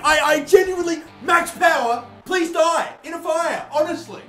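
high, loud furious rant; angry rant. Real, not acted. Gamer raging at Max Power after his penalty, angry. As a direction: vent at the player who beat you. Gamer ranting at the rival striker.

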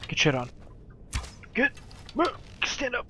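shouted encouragement, loud; loud cheering. Real, not acted. Gamer shouting encouragement at companion Sven. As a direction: egg your buddy on loudly.

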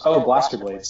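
ordinary delivery

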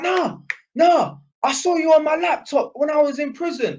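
high, loud Cockney impression; put-on Cockney voice, high and insistent; Cockney accent. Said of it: "that's how I'm doing a Cockney accent".

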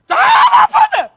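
loud high shout with a laugh in it; half-laughing yell. Acted. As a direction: bellow at him to come stand here. Teacher calling boy over.

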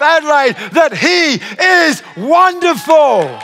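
loud, high, drawn-out proclamation; impassioned preaching. Real, not acted. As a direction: preach it to the whole church, stretching the big words. Preaches at full force.

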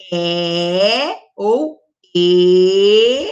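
very slow "E ou E", first "E" closed, then open; two E sounds. Shown as a demonstration. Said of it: "olha o som".

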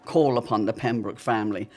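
measured radio-serial recap narration; dramatic narrator. A narrator's reading. Narrator recapping the Pembrokes' story for listeners, ominous. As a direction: set up the danger like an old radio announcer.

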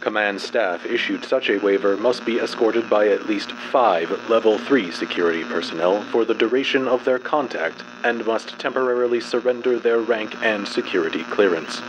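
usual speaking voice

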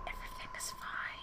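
soft eerie whisper, barely there; a ghostly reassurance. Acted. Creepy whispered game text.

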